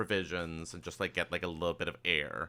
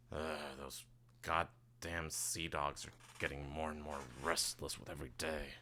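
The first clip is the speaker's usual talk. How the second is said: grumbled softly and lower, half to himself; low grumble; 5 semitones lower, 11 dB quieter than usual. Acted. Captain complaining about the sea patrol, grumbling low. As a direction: drop to a quiet, low grumble.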